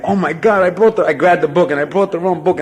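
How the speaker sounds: high, loud panic over the wrong book; retelling a panic about the missing book, loud and high-pitched; panicked. Retold or imitated. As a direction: freak out in a high rush. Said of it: "I'm freaking out".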